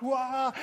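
high-pitched whooping noise; high playful "wah". Acted. Excited angel swooping at the tomb, high playful cry. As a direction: let out a high, cartoonish wah. Angel's antics before the soldiers.

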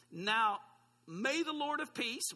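slow, weighty reading of the verse; scripture reading. Acted. Pastor reading Paul's blessing to the congregation, slow and solemn. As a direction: read it out slowly, like holy words.

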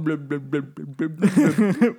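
gurgly fish-head blub, slowing; fish-man blubbing. Pretend. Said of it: "you walk around going".

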